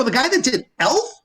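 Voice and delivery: high, rising incredulous question; double-check it in comic astonishment; retold disbelief. Teller reacts to the director.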